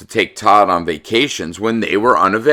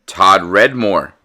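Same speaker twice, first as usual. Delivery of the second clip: usual speaking voice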